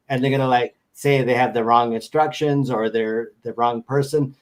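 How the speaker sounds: normal talking voice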